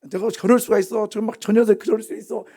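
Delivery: high, worked-up ranting; acted anger. Preacher plays an angry parent to a bystander, ranting. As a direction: rant in outrage about that kid. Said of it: "막 그렇게 화나는 건".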